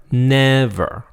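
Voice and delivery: NEVER held high and level; first tone. Shown as a demonstration. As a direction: stretch NEVER on a high, even pitch. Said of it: "sounds like a first tone".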